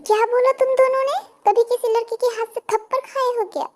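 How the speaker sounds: high, fast indignant retort; indignant challenge. Acted. Girl confronts two street harassers, indignant. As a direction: square up with a high, offended voice.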